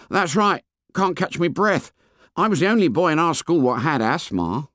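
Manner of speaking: high schoolboy voice, a little proud; fat boy with a touch of pride. A narrator's reading. Fat boy tells Ralph about his asthma, mildly proud. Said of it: "with a touch of pride".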